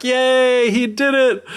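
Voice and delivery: high, drawn-out celebratory cheer; mock cheering. Elders sing Anansi's praises, in a high mock cheer. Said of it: "they all start cheering".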